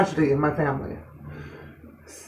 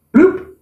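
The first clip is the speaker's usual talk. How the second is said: comic drop noise; 10 semitones higher than usual. Retold or imitated. Mother dropped onto gurney.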